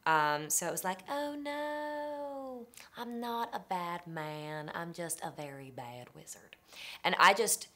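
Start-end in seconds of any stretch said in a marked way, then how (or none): 1.1-4.7 high, slow Southern drawl of a gentle man; effeminate Southern wizard. Retold or imitated. Wizard pleading his case in a Southern accent, slow. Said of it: "effeminate Southern man". 4.7-6.6 put-on Southern accent falling into a slow whisper; whispered Southern wizard. Wizard admitting his failing, Southern whisper. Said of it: "effeminate Southern man".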